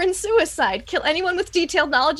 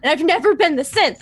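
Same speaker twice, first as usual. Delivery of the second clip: ordinary talk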